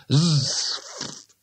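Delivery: "Zf" as a long buzzed z fading into f; spell pronunciation. A narrator's reading. Two Flower offers his guess, "Zf" as a drawn-out buzz. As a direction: draw out "Zf" with no vowel. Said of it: "it should be pronounced".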